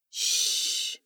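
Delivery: long soft "shh"; shushing. A narrator's reading. Hushing Naomi as nearby diners glance over, shushing. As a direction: hush a friend with a drawn-out hiss. Hushing friend after near shout.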